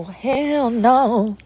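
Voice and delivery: ordinary delivery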